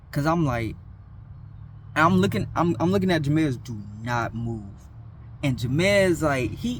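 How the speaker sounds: ordinary talk, nothing marked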